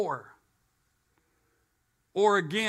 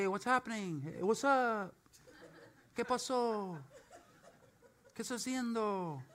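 softer, offhand street-greeting voice; casual greeting; 3 semitones higher, 8 dB quieter than usual. Retold or imitated. Preacher imitating friends greeting casually, half in Spanish. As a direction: toss off a lazy hey. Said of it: "You say".

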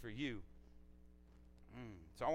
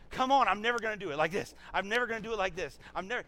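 high, loud, urgent plea; pleading; 6 semitones higher, 6 dB louder, faster than usual. Acted. God vowing never to do it again, urgent pleading. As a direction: plead insistently, pitched up and pressing. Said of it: "he's, like, pleading with us".

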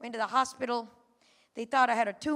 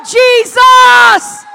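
loud, high shout of the name; shout Jesus; 12 semitones higher, 26 dB louder than usual. Shown as a demonstration. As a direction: show them how to shout it. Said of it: "stand up and shout to God".

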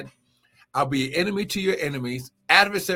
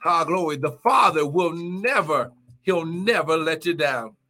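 fiery preaching; 4 semitones higher than usual. Acted. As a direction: shout the praise, build the fire. Preaching at full force.

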